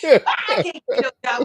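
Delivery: half-laughing mock complaint, pushed out; laughing retelling. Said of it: "I'm like".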